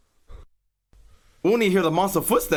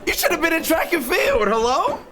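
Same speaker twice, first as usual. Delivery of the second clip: panicked high yelling; 13 semitones higher than usual. Real, not acted. Streamer fleeing the monster, yelling high in panic. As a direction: yell it out high, in full panic.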